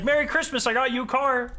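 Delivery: high, chirpy mock commercial voice; cheesy ad voice. Spouse in a holiday car commercial, chirpy and high. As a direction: play the gushing TV ad spouse. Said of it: "these commercials where it's like".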